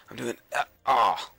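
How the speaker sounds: usual speaking voice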